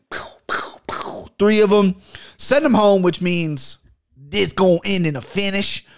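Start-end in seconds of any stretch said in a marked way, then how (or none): 0.1-1.3 soft whispered pew sounds; quiet, airy shooting noises; imitated gunshots. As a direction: mouth three hushed laser shots. Imitates three shots for locks.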